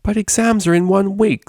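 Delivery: high, whiny protest; sulking. Acted. Cellist sulks at her roommate, high and whiny. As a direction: sulk like a pouting student. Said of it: "sulked the cellist".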